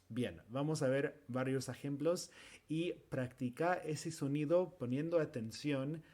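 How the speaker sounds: normal talking voice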